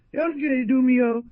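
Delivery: high, slow, drawn-out cry of joy; joyful cry. Acted. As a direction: call his name out in delight. Old woman greets sick man.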